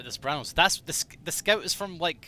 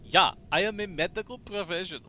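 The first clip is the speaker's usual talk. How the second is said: a hint of a German accent, higher; German Medic attempt; 5 semitones higher than usual. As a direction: try a German doctor voice, lightly. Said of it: "What the fuck is this accent?".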